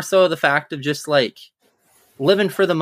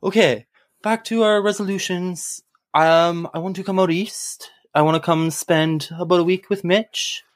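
put-on Canadian accent. Host doing his resolution intro for listeners in a hammed-up Canadian accent. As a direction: ham up a proper Canadian accent. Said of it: "Does anybody in Canada talk like that?".

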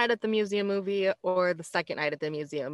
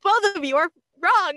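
high, loud exasperated call; mock outrage; 10 semitones higher, 6 dB louder than usual. Scolding both movie characters for mispronouncing, in mock outrage. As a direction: burst out high and loud, drawing out the words. Acted outburst at films' mispronouncers.

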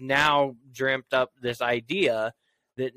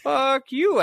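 mock refusal; 11 semitones higher, 3 dB louder than usual. Refusing to enter with cow.

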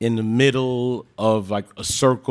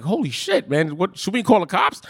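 retold freaking out; 7 semitones higher than usual. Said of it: "I was, like, freaking out".

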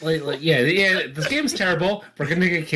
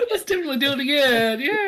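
laughing; 12 semitones higher, faster than usual. Real, not acted. Mocking the quiz, laughing.

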